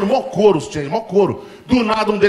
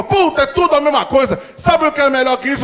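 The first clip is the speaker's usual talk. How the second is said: excited dad rant; 8 semitones higher than usual. Retold or imitated. Dad repeating the same rant.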